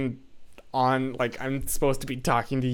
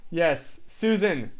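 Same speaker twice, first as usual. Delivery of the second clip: ordinary talk, nothing marked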